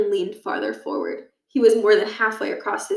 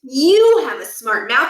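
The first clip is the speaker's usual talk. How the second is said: slow, louder sneer; 4 semitones higher, 6 dB louder, slower than usual. Acted. Principal sneers at Holly across his desk, slow and louder. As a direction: sneer the insult down at her. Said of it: "Barkin sneered".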